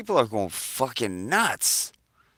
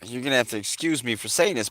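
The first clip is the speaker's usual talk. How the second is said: ordinary talk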